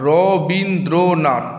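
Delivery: "Rabindranath" slowly in four beats, র, বিন, দ্র, নাথ; split into syllables. Shown as a demonstration. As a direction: draw out each syllable of "Rabindranath". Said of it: "তাহলে কটি অক্ষর".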